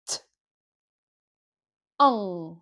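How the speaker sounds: T alone, then a long An; slow sound by sound. Shown as a demonstration. Teacher saying a syllable slowly for pupils, sound by sound. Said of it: "Je vais dire ton tout doucement".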